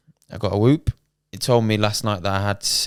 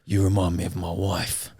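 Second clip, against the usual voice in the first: low, slightly softer mock voice; mock put-on voice; 3 semitones lower, 4 dB quieter than usual. Retelling a joke made at a kid in a game lobby, low mock voice. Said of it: "And then I went".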